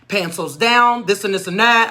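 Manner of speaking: ordinary delivery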